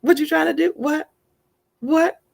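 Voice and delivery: scoffing devil voice, pitch climbing to the last What; taunting devil voice. Acted. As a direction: needle her by name like a jeering little devil. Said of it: "it gets really, really loud".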